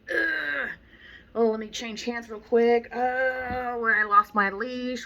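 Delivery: hammed-up panic over a lost leash; mock flustered owner. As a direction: play the clumsy owner fumbling the leash. Voicing flustered student.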